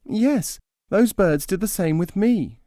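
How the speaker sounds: high plaintive bird voice; small and hurt; forlorn nightingale. Acted.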